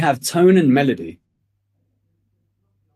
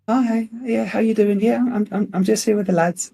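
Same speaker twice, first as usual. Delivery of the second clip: put-on much higher, sugary voice for the girlfriend; sweet phone voice; 8 semitones higher than usual. Guy answers his girlfriend's call while with the lads, high and sugary. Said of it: "your tonality changes".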